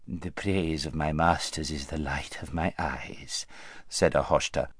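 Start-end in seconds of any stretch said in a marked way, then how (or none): 0.1-3.5 soft, fawning courtier voice; oily scheming vizier. Acted. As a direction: flatter him in a soft, oily voice. Vizier flatters the prince.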